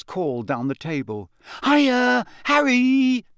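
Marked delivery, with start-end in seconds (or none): 1.6-3.2 loud, high, drawn-out greeting; breathless young fan squealing hello; highly excited. Acted. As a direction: call eagerly down the table. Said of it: "a highly excited, breathless voice".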